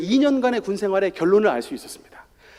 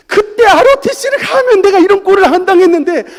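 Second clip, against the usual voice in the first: tearful loud protest, much higher and louder; crying protest; 10 semitones higher, 9 dB louder than usual. Retold or imitated. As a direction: cry out to God in complaint. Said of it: "하나님 앞에 얼마나 항변을 했나". (하나님께 울며 항변하듯)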